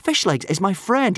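put-on high, insistent boy's voice; protesting. A narrator's reading. Son defends his friend to father, protesting. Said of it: "protested Hiccup".

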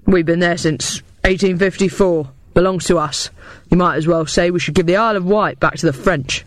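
put-on gruff old soldier holding his ground. A narrator's reading. Veteran arguing the Crimea belongs to us, gruff. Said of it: "added Stamford gruffly".

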